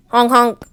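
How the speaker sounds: loud honking goose call. Acted. Goosey Lucy calling out to her friends, loud honks. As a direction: honk like a goose. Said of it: "called out Goosey Lucy".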